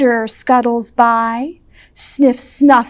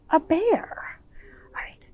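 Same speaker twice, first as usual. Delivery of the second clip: teasing whispered hint; 4 semitones higher, 10 dB quieter than usual. Real, not acted. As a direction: whisper it like a little secret.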